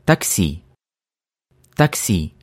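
ordinary talk

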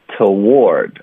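"toward" drawn out slowly, weak t then full ward; demonstrating toward. Shown as a demonstration. Teacher answers the second word, slow model "toward". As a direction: stretch "toward" with the weight on the second syllable. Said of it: "一般就发t就可以了".

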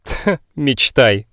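slow, dismissive scoff; scoffing snort. Acted. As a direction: snort it off with contempt. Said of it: "фыркнула Ольга".

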